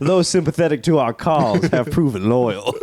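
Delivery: rally them like a Confederate officer; a hint of a Southern general; mock southern general. Southern general role play.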